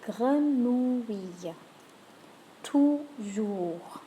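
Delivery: "Grenouille" stretched very slowly, "Toujours" clear; slow French model. Shown as a demonstration. Teacher gives learners words to repeat. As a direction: draw out "Grenouille" syllable by syllable. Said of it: "have a go at those".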